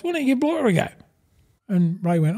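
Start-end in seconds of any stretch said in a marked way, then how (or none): 0.0-0.9 much higher voiced quote of a mate's suggestion; lightly voiced Bozo, pitched high; imitating Bozo. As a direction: pitch it up for the mate.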